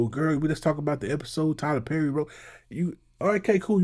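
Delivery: ordinary talk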